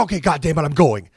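loud determined outburst; talking himself into going back, loud; loud resolve. Retold or imitated. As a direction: shout yourself into going. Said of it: "So then I go".

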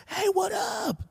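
drawn-out high "Hey, what up?" called out; mock loud greeting. To a girl he knows from school, a mock loud greeting. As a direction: yell the greeting slow and high like a bro. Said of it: "you don't like".

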